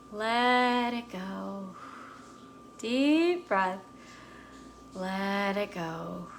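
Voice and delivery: very slow, drawn-out soothing delivery; calming breath guidance. Shown as a demonstration. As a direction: stretch each phrase out with the breath. Leading a deep breathing exercise.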